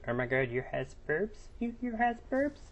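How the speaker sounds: put-on tiny, high, soft little-kid voice with rising questions; childish troll voice. Troll putting on a voice for a girl in the lobby, childish. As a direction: squeak it softly like a little child. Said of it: "I put on this little childish voice".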